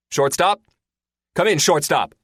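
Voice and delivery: high, loud calling out; shout. Acted. Pilot shouts for a lost wingman on the comm, urgent. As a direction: call him urgently over the radio. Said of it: "Porthos shouts".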